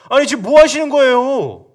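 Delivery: loud, high protest at the leader; loud outburst. Shown as a demonstration. Soldiers loudly protesting to David. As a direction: blurt it out loud in shock. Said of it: "이렇게 말 못했겠죠, 크게는".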